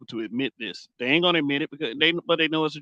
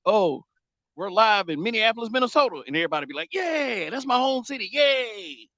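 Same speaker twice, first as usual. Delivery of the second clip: high, hammed-up arena hype; mock wrestler promo and cheering crowd; 9 semitones higher than usual. Wrestler hyping a hometown crowd, hammed-up and high. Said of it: "a wrestler come out there and says".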